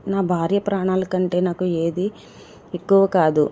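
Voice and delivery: usual speaking voice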